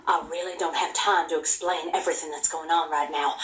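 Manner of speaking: normal talking voice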